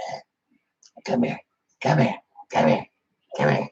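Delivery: put-on low, breathy rasp of a creepy old man; faint mumbled come here, dropping very low at the end; Sling Blade voice. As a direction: croak it out like the Sling Blade character. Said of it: "you ever see Sling Blade?".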